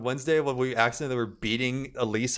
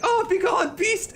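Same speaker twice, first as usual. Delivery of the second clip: much higher, louder hammed-up cry; mock shrieking old woman; 7 dB louder, slower than usual. Retelling Elise chasing the girl off, hammed-up shriek. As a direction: shoo a monster off in mock alarm. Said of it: "And she's like".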